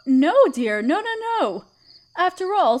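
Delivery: usual speaking voice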